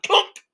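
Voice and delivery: sharp high, loud clunk sound; comic loud clunk effect. A narrator's reading. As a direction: bang out the crash like a sound effect. Guards hit bottom of stairs.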